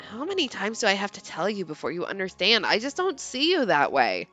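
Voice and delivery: put-on low, tired teenage boy; low weary boy. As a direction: brush her off with a sigh. Leon voiced in read story.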